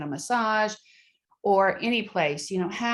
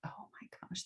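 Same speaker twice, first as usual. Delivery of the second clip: whisper; 21 dB quieter than usual. Real, not acted. Whispered amazement.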